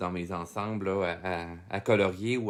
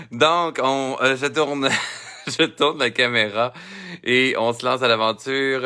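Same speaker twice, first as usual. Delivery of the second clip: laughing; 3 semitones higher, 8 dB louder than usual. Real, not acted. Still laughing, turning the camera.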